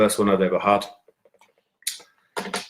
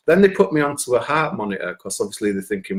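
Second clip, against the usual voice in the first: normal talking voice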